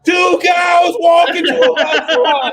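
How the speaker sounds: laughing shout. Acted. Comic restarting the joke again, laughing shout. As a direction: bellow the opener, cracking up.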